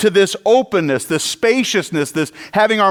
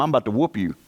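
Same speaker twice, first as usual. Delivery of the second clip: put-on East Texas accent; 8 semitones lower, 6 dB quieter, much faster than usual. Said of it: "he had to do that accent too".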